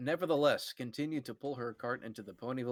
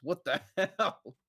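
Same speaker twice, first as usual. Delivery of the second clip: laughing; 6 semitones higher than usual. Acted. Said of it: "The donation reader cleared his throat".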